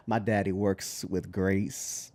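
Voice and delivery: slow, low Alabama drawl; soft, drawn-out Alabama twang; Alabama kid accent. Retold or imitated. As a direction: imitate a little boy's Alabama accent, quietly. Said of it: "kid is from, actually from Alabama".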